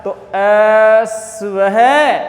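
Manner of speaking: अश्व है with a short half स and long है; half-letter reading. Shown as a demonstration. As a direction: clip the स, stretch the ending. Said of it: "अर्धाक्षर".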